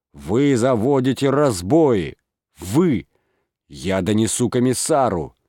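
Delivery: the mayor rages until words fail him; enraged mayor threatening his men; furious tirade. Acted. Mayor berates the constables.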